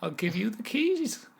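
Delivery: put-on Shire accent. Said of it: "this is as close as I can do it".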